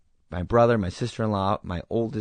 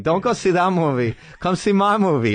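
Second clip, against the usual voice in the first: hammed-up Tommy voice; 8 semitones higher, 4 dB louder than usual. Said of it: "And he said".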